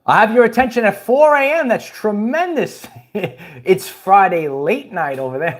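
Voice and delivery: normal talking voice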